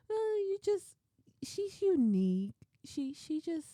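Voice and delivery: high, hesitant, tiptoeing answer; evasive hedging. Retold or imitated. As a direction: imitate someone searching for a tactful word. Coworkers tactfully avoiding describing Monica.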